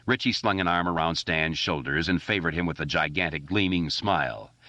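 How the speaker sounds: ordinary delivery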